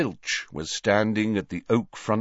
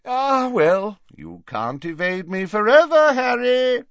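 loud, high theatrical farewell; booming professor, playfully warning; jovial, dramatic Slughorn; 13 semitones higher, 6 dB louder than usual. Acted. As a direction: call it out grandly, building louder.

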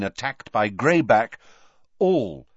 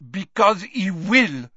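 put-on French accent, slow; 9 semitones higher, 5 dB louder than usual. A narrator's reading. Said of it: "said Fleur".

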